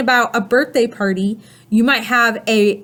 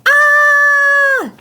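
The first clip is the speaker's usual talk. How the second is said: high, loud, held "ah" scream; long, high-pitched yell of fright; 6 dB louder than usual. Retold or imitated. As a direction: re-enact a spider-scare yell. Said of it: "I yelled".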